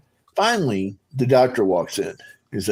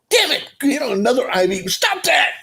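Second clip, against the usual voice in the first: high, exasperated complaint; fed up with the needles; retold annoyance, pitched high; irritated outburst. Being stuck with another IV.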